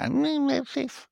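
high, slow whiny mimicry; mocking killjoy voice. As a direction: drag it out high like a spoilsport. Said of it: "and you have to go".

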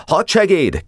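high commanding summons; calling out. A narrator's reading. Commander summoning his adjutant, calling out. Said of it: "kiáltott".

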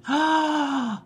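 a sharp high gasp of fear; gasp of dread. Retold or imitated. Girl dreading her father's beating, high frightened gasp.

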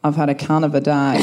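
broad low Australian accent, laughing. Shown as a demonstration. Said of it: "in my original Australian accent".

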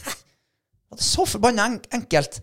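high, disbelieving "what?" at how simple it was; suddenly see the obvious and can't believe it; astonished realization. Retold or imitated. Telling a friend how his dog finally came back, high astonished disbelief. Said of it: "jeg tenkte bare".

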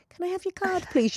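the nice way to ask for the card; polite request. Retold or imitated. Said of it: "'cause you did not say".

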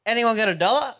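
pestering cadger. Retold or imitated. Dealer asking anyone in the pub, mock pestering. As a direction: imitate a cadger calling round for change. Retelling man pestering people for money.

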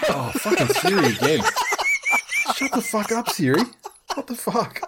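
high, loud laughing outburst; laughing exasperation. Real, not acted. Host tells off interrupting Siri, laughing exasperation. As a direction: curse at it through helpless laughter.

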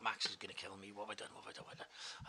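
very soft, fading into a near whisper; worried muttering. Retold or imitated. Man fearing Max will kill him, muttering. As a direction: mutter it to yourself, trailing away. Acted worried thought in retelling.

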